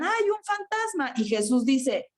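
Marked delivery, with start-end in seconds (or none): 0.0-1.1 high, mock-frightened cry of a ghost sighting; retold fearful cry. Disciples crying out at a ghost in the storm, mock-frightened high cry.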